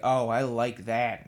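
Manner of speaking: loud, drawn-out imitation of approval; slow, emphatic mock relish; relishing delight. Painter retells the daemons' reaction to howling banshees, relishing. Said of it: "they were like".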